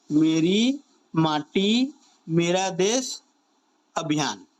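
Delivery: word by word, with pauses between; slow dictation. Shown as a demonstration. Teacher repeating a campaign name for students to remember, slow dictation. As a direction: spell out the name slowly, piece by piece.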